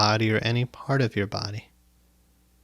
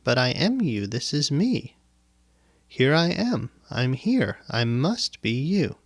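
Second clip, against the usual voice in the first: higher, swooping voice of the mind; insistent mind; 3 semitones higher than usual. Acted. Mind arguing with the observer, insistent. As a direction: plead your case as the mind claiming to be the self. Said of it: "Now let your mind say".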